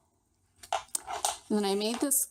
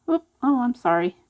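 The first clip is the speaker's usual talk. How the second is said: startled oops; 3 semitones higher, 6 dB louder, faster than usual. Real, not acted. Crafter startled mid-demo, blurting oops then a quick sorry. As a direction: caught off guard as something slips, then sorry.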